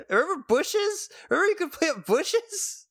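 very high-pitched, giddy outburst; excited squeal. Real, not acted. Gamer reminds co-host of a missing feature, excited squeal.

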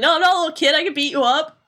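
whiny. Retold or imitated. Sora protesting to Squall, whiny. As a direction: imitate an indignant, whining little kid. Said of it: "Sora gets all whiny".

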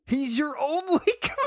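high, fast, laughing disbelief; comic indignant outburst; exasperated protest. Pretend. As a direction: plead the obvious, half laughing. Arguing with character in disbelief.

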